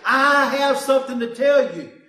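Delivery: loud, commanding call to listen; Jesus demanding attention. Acted. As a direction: command the crowd as the Lord would. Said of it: "he's saying".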